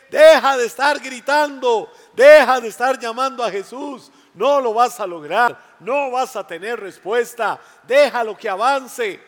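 loud, high harsh rebuke; scolding shout. Acted. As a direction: tell him off loudly. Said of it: "Qué le decía a la gente".

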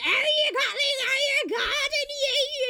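very high, loud, garbled dialect wailing; hammed-up incomprehensible rustic shouting; Joseph. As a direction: yowl in unintelligible old-man dialect, all vowels. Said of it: "he's just there like".

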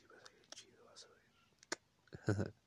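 breathy whisper, no voice. Real, not acted. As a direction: whisper it close to the mic. Hushed promise to listeners.

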